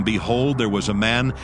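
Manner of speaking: ordinary delivery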